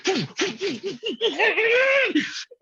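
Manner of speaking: high, long laugh that falls away; long helpless laugh. Real, not acted. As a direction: laugh until you lose it. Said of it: "I just lost it".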